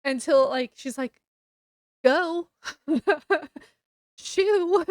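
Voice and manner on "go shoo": dismissive shoo. Retold or imitated. Rich girl shooing off doting maids, dismissive. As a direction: shoo them off loud, high and laughing.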